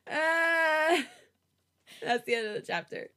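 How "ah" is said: high, loud fangirl "ah"; real gushing shriek; delighted squeal. Real, not acted. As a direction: squeal loudly at something cute. Gushing over the cute chapter ending.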